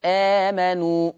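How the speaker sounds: long, held first vowel in آمنوا; madd al-badal. Shown as a demonstration. Teacher showing students madd al-badal, آمنوا with drawn-out first vowel. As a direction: stretch the opening of آمنوا in slow recitation. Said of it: "مد البدل".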